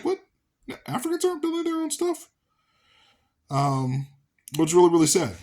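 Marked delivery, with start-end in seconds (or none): 0.0-2.3 high, stunned "What?" in disbelief. Retold or imitated. Recalling his shock at who built African cities, mock disbelief. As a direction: act out his own shock, voice shooting high.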